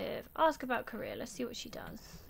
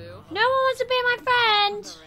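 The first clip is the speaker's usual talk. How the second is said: hammed-up whine, much higher and louder, slow; mock wail; 12 semitones higher, 10 dB louder, slower than usual. Gamer wailing at sims who all refuse her friendship. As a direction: wail it like a rejected kid. Rejected by every sim in game.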